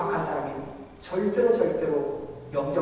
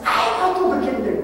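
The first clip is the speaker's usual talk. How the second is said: much higher, louder irritated outburst; angry yell; 9 semitones higher, 5 dB louder than usual. Retold or imitated. 초인종 누른 집사에게 짜증 내며 소리치는 여자, angry yell. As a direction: snap back in annoyed shout. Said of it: "그랬더니 소리 지르면서".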